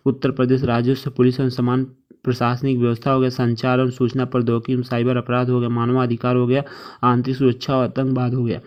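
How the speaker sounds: ordinary delivery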